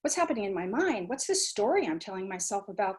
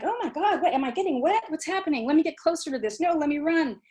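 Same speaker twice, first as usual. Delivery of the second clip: put-on a touch of giddy child, higher and louder; excited child at sprinkler; 5 semitones higher, 3 dB louder than usual. Teacher acting a child at a sprinkler, mock excitement. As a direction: squeal it mildly like a kid getting wet. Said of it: "just being like".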